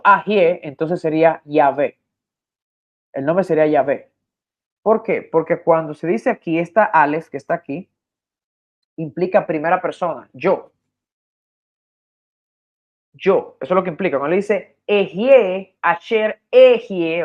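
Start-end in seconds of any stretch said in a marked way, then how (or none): 0.0-0.6 Ajie with an open A first; A-initial pronunciation. Shown as a demonstration. Teacher supposing the causative form, Ajie said with an A. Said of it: "que le da el sonido A". 14.9-17.2 Ejie, Asher, Ejie slowly, each E clear; stressed initial E. Shown as a demonstration. Teacher quoting Hebrew so listeners hear the E, Ejie drawn out. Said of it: "oigan la E al principio".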